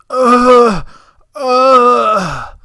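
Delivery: loud, long mock groan of strain; effortful groan. Imitating tired helper getting up, loud mock groan. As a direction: groan as if heaving yourself up.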